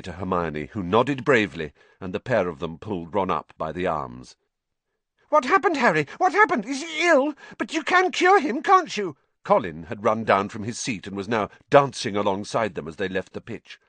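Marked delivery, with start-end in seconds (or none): 5.3-9.2 put-on very high, loud, excited boy's voice; eager young fan. A narrator's reading. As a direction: pepper him with anxious high-pitched questions. Voices eager Colin Creevey.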